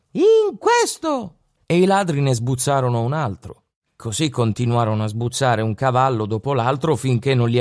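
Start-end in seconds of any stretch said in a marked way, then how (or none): 0.1-1.4 put-on tiny high-pitched boy's voice, slow. A narrator's reading. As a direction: speak like a tiny boy answering from inside a horse. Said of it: "rispose una vocina". (vocina acuta e minuta)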